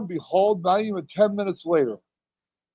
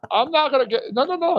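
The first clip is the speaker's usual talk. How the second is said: high-pitched protest; 9 semitones higher than usual. Retold or imitated.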